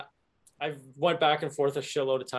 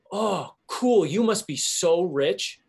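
retold sarcasm; 3 semitones higher, slower than usual. Driver needling the parking lot owner, sarcastic. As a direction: feign awe, stretching the words slowly.

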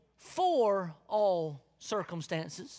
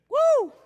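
loud high celebratory woo; joyful whoop; 11 dB louder than usual. Acted. Preacher whooping to show excited joy.

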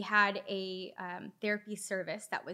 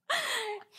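nervous laugh. Real, not acted. Awkward joke to sick dad.

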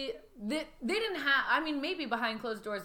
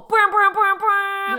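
high, loud mouth-made fanfare; punchy vocal fanfare; imitated fanfare; 10 semitones higher, 15 dB louder, much slower than usual. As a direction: blare it out like brass. Imitated fanfare after Super Bowl line.

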